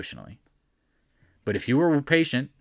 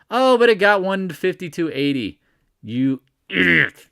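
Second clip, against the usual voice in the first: mock insult; 4 semitones higher, 5 dB louder than usual. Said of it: "I'm just trying to be funny".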